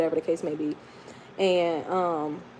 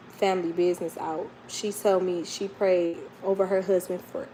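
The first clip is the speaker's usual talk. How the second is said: ordinary talk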